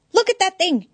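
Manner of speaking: high excited exclamation; exclaiming. Acted. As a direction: cry it out, voice way up. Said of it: "Eddie exclaimed".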